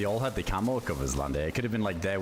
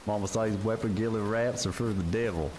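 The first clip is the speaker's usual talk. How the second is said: put-on Forrest Gump southern accent; 4 semitones higher than usual. Gamer reads a mod line to Cody in a Southern American accent. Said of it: "almost like Forrest Gump".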